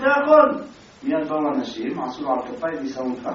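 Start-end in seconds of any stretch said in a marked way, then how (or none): 0.0-0.6 anguished cry. Retold or imitated. Man in agony, can't walk.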